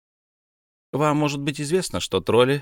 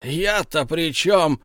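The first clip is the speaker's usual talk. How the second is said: slow, high indignant yell; a drawn-out wail of innocence; 6 semitones higher, slower than usual. A narrator's reading. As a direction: Tom crying out, drawn out and higher. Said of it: "завопил Том".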